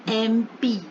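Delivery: एमपी said as "em-pee", opening on a clear ए vowel; pronunciation demo. Shown as a demonstration. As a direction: model एमपी starting with an ए sound. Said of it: "जैसे मैं बोलती हूँ".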